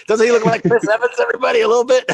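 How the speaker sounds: loud, high laughing tease. Real, not acted. Teasing viewers that his trainer looks like Chris Evans, laughing. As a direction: laugh through the joke to viewers.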